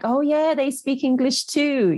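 high-pitched quote of people conceding; mock concession. Voices people conceding the point.

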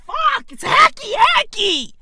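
high-pitched comic exasperation; exasperated outburst. Real, not acted. Comic berating his own hacky material, exasperated outburst. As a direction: wail it high at your own bad joke.

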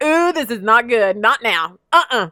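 alarmed. Retold or imitated. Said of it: "I was like".

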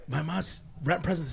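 usual speaking voice

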